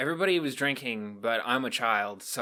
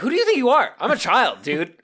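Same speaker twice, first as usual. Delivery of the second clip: indignant, much higher and louder; outraged high yell; 12 semitones higher, 11 dB louder than usual. Retold or imitated. Teen telling off the coworker, outraged high yell. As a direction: yell it like a shocked kid. Retelling his outraged reaction.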